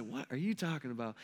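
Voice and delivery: low, soft, sleepy deadpan; calm, unbothered reply. Retold or imitated. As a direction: answer panic with a quiet murmur. Jesus' calm reply to panic.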